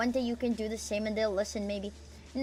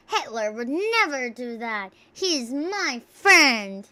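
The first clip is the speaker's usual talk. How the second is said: mock defence; 7 dB louder, much slower than usual.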